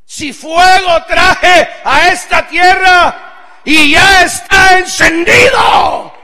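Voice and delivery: very loud, high, slow yell; Jesus shouting. Retold or imitated. Jesus yelling at scared disciples, slow and high. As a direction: call out over frightened men. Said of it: "les grita y les dice".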